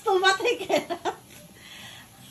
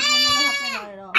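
teasing; 8 semitones higher, faster than usual. Real, not acted. Mother refuses the money.

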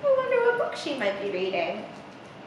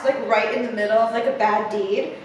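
plain reading voice

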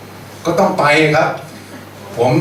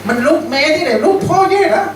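much higher mocking taunt; teasing; 10 semitones higher than usual. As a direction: jeer at him like a cruel older brother. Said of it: "เรียก".

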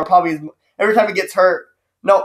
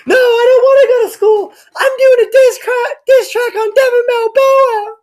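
put-on whiny crying kid; 6 dB louder than usual. Mocking the kid crying to his mom, in a wail. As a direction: bawl it like a spoiled kid. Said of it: "He's probably".

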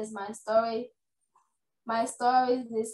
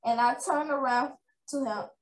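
ordinary delivery

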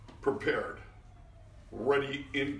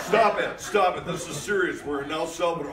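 a half-laughing mock scold, louder; 2 semitones higher, 8 dB louder than usual. Director scolding joking actors on set, a laughing mock scold. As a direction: pretend to tell the jokers off.

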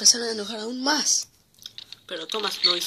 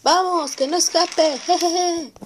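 cackling mischievous trucks; 9 semitones higher, 6 dB louder, slower than usual. Acted.

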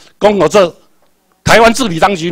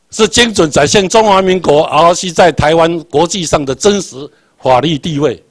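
normal talking voice

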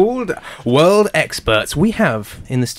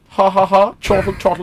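spoken fake laugh; 4 semitones higher than usual. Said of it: "chortle, as you would say".

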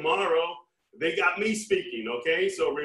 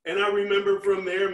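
ordinary delivery

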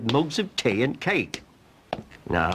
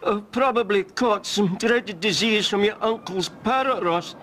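higher, hammed-up feeble bravery; fake-brave invalid; 10 semitones higher than usual. Cromarty faking illness to dodge work. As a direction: lay it on thick, pretending to be ill.

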